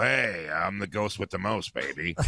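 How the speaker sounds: low, slow impression, sinking on the last word; botched impression in a low, slow voice; Charlie Sheen. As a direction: drop low and drag out the catchphrase. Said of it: "because of the tone of the voice".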